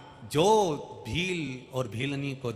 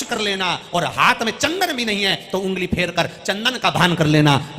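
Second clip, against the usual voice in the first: raised, insistent, chant-like sermon delivery; impassioned preaching; 8 semitones higher, 6 dB louder, faster than usual. Real, not acted. Preacher telling devotees what Shiva never demands, forceful and insistent. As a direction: drive each repeated phrase home.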